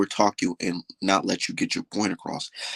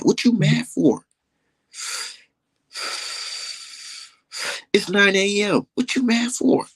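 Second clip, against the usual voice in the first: mock him for getting mad; taunting; 10 semitones higher than usual. Real, not acted. Taunting the angry old man.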